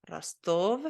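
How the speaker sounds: ordinary delivery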